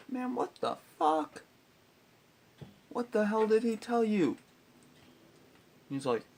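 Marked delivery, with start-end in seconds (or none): 0.1-4.4 stunned high-pitched what-the; incredulous outburst. Retold or imitated. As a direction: pitch way up in outraged confusion. Disbelief at the office accusation.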